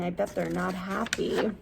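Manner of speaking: ordinary delivery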